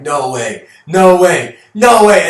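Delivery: building from plain to a high loud shout of horror; horrified father. Retold or imitated. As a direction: escalate into a horrified scream. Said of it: "you're like".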